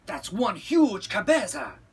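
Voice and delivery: loud high cartoon amazement; exclaimed. A narrator's reading. Kitty boy measuring his head, exclaiming loudly. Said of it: "exclaimed Skippy John Jones".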